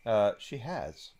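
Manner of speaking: ordinary talk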